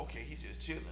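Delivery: normal talking voice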